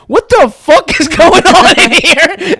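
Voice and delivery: high, loud, drawn-out coach bellow; mock outraged yell. Coach walking in on the team, yelling. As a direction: imitate a coach bursting in appalled.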